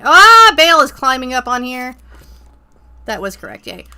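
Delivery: normal talking voice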